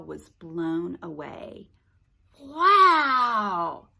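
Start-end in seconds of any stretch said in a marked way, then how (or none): 2.4-3.9 loud, awed exclamation; amazed wow. Acted. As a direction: gasp it in wonder. Kyle amazed.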